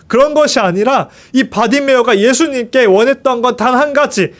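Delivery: ordinary talk, nothing marked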